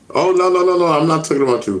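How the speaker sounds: high, loud protest; loud denial. Real, not acted. Streamer loudly denying the chat's accusation. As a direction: shout it down fast. Denying being a cock blocker.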